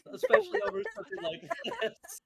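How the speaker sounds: high, loud, fast words through laughter; laughing hard. Real, not acted. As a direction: gasp it out while cracking up. Still laughing at the name.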